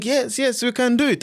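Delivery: lightly acted rallying cry, pitched high; imitating eager supporters. Host quoting the movement's supporters, lightly mimicked eagerness. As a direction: raise the pitch like a keen supporter.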